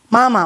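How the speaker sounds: mamá low, stressed on the first syllable; misplaced accent. Retold or imitated. Mother showing how Leslie calls her, misplaced accent. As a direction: say mamá as MÁ-ma, dropping at the end. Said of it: "con la tilde donde no va".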